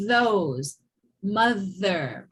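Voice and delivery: Those, mother with voiced TH; teacher models Those and mother; voiced TH demo. Shown as a demonstration. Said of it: "All right?".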